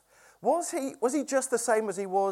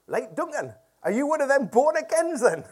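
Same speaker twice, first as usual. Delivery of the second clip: put-on higher, louder local Morpeth accent; Morpeth builder's accent; 6 semitones higher, 4 dB louder than usual. Preacher mimicking campsite owner quizzing him, Morpeth accent. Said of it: "how do you say it?".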